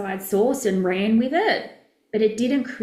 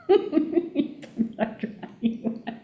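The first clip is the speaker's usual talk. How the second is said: airless, unvoiced giggle; amused breathy laughter; 5 semitones higher, 3 dB quieter than usual. Real, not acted. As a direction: laugh silently through the nose. Laughing at wet-hair remark.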